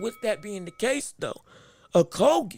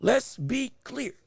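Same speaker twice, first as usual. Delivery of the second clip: usual speaking voice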